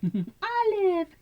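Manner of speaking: high pet-talk squeal; high-pitched doting call; cooing to a kitten. Real, not acted. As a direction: sing out the kitten's name, high and sweet.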